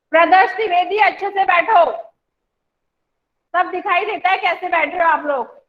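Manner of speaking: ordinary talk, nothing marked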